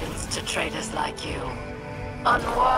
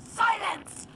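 angry silencing; 12 semitones higher than usual. Acted. Cuts off Verix angrily.

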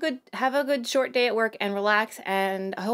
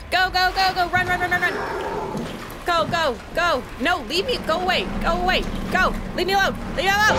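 panicked yelling; 7 semitones higher than usual. Real, not acted. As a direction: yell in panic while fleeing. Fleeing monster, yelling.

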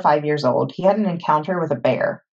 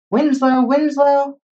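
high, louder searching call; 10 semitones higher, 5 dB louder, slower than usual. A narrator's reading. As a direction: call out for a lost donkey. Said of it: "calling for the donkey".

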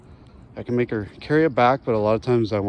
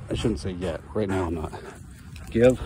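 ordinary talk, nothing marked

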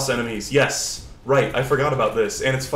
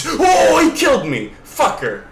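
loud, high yell dropping as it goes; real indignant shout; shocked outcry; 14 semitones higher, 7 dB louder than usual. Real, not acted. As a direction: cry out at a sudden game death. Killed suddenly in the game.

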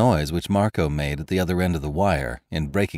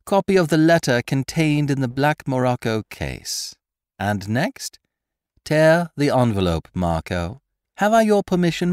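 lightly raised, mocking gentleman thief; Lupin's voice; 8 semitones higher, 4 dB louder than usual. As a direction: needle the prisoner with sly amusement. Lupin directing Marco.

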